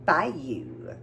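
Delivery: "Bayou" said slowly as BY-oo, two clear syllables; careful pronunciation. Shown as a demonstration. Saying the seasoning name carefully for her friend. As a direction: carefully sound out "Bayou" with a falling end. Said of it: "I need to pronounce it right".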